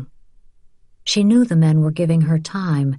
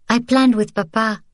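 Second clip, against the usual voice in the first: put-on child voice; 6 semitones higher than usual. A narrator's reading. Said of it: "Sophie said".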